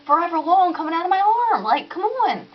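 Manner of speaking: high, fast, exasperated outburst; indignant frantic rant; frantic complaint. Retold or imitated. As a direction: vent the panic at the operator. Reliving panic at operator.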